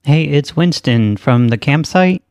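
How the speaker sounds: ordinary talk, nothing marked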